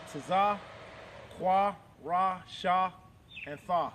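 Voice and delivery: normal talking voice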